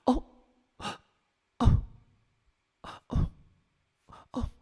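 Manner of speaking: short, hammed-up gasps; mock distressed gasps. Reacting to a Reddit downvote, melodramatic gasping. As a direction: gasp as if mortally wounded.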